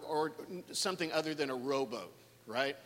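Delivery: ordinary talk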